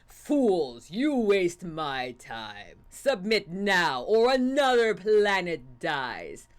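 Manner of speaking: slow, low evil monologue. Acted. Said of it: "it's probably more evil".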